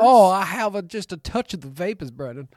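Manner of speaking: hammed-up Southern belle, starts loud and high, then eases down. Co-host teasingly asked about the vapors, answers as a mock Southern belle. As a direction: play a swooning Southern belle. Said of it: "suddenly slipping into Southern Belle".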